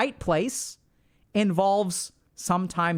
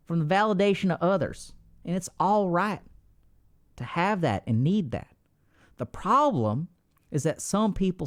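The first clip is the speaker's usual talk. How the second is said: put-on Texan accent. Streamer teaching chat about validation, in a put-on Texan accent. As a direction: preach it like a Texan with homespun sayings. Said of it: "We'll do the next one in Texas".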